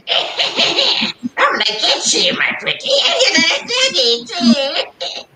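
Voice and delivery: high, slow, screechy witch with a laugh. Pretend. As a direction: cackle it like a wicked witch. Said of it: "I always do that witch voice".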